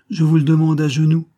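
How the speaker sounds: loud, high, fervent entreaty; impassioned plea. A narrator's reading. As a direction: beg aloud as if on your knees. Count pleads for her hand. (supplication fervente, à voix haute)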